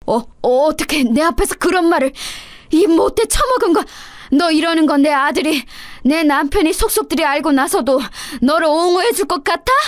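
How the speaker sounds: shocked mother-in-law sputtering with anger; sputtering rage. A narrator's reading. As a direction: choke on the insult then lash out.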